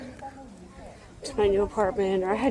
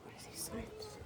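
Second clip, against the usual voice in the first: whisper; 13 semitones higher, 21 dB quieter than usual. Real, not acted. Reading signs quietly at zoo.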